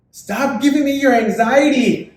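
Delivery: high, loud, put-on voice of a friend; loud high plea, drawn out; mock high pleading. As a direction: squeak it out like a nervous friend. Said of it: "she turns to me and she goes".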